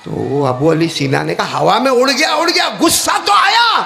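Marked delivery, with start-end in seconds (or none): 1.6-3.8 acted rising outburst; hakim's heated protest, high-pitched; exasperated anger. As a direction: climb in pitch to an indignant peak. Angry hakim's exasperated answer.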